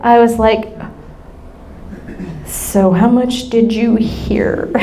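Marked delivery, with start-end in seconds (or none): 2.5-4.7 slow, hesitant asking; cautious question. Retold or imitated. Mother gingerly asking her daughter, slow and cautious. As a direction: ask it carefully, dreading the answer. Careful question, contrasted with later wail.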